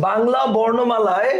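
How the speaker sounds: "Bangla বর্ণমালায়" drawn out slowly for writing down; slow dictation. Shown as a demonstration. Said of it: "লিখো". ("বাংলা বর্ণমালায়" ধীরে টেনে শ্রুতলিপির মতো)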